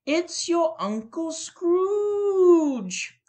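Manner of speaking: slow, high, drawn-out reveal; triumphant shout. A narrator's reading. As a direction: stretch it out in triumph. Sister guesses the answer.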